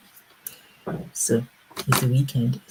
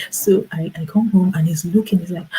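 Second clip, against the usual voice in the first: usual speaking voice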